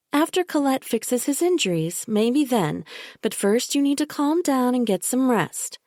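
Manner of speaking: a sickly-sweet caretaker who won't be argued with; Bella, falsely soothing. A narrator's reading. Bella insisting to the narrator that she hurt Michael, falsely soothing. Bella voiced as a higher figure.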